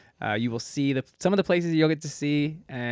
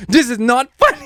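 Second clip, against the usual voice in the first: loud, high imitation of a cross friend; mock outrage; 10 semitones higher, 14 dB louder than usual. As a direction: mimic an indignant mate at full volume. Retelling friend's upset reply to joke.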